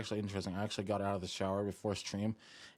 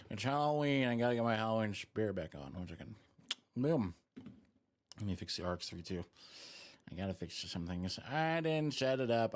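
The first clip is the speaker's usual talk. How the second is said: normal talking voice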